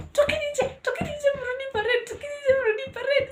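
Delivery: very high, squeaky mock shouting; shouting deputy. Deputy banging on doors to clear students out, high mock shouting. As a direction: rattle off orders as an imitation of a yelling deputy. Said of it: "Ana shout akigonga kwa milango za watu".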